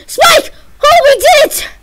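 high, loud triumphant cheer; victory cheer. Real, not acted. Gamer cheers as Spike the boss dies, high and loud.

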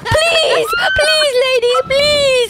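high, loud pleading shout; excited shout. Real, not acted. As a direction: beg at the top of your voice. Begging loudly for the game.

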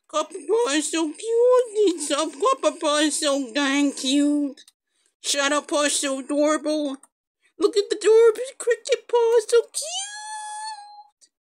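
high-pitched cooing, rising to a squeal; cutesy gushing. Real, not acted. Fawning over the cute new squad members, gushing. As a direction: gush like you're melting over babies.

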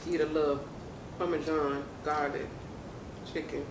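speaking between bites; chewing while talking. Real, not acted. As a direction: mouth partly full of chicken. Seen 3: Both women eat; left bites and chews chicken throughout, speaker unclear.

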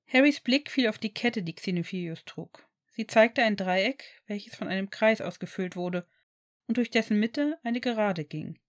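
usual speaking voice

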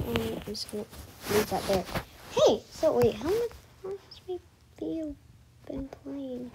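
normal talking voice

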